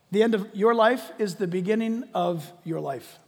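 ordinary talk, nothing marked

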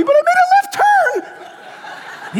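high, loud squeal breaking off into breath; comic high-pitched fretting; mock panic. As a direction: wail it in hammed-up panic. Acts out a worried believer's confession.

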